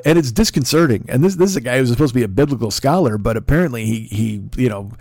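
usual speaking voice

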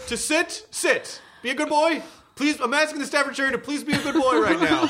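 high-pitched, insistent mock officer; hammed-up cop giving formal commands; cop speak. Mock cop asking a dog to behave, high insistent cop speak. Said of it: "go right into cop speak".

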